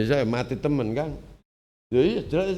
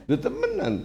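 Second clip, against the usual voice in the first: amazed; 3 dB quieter than usual. Real, not acted.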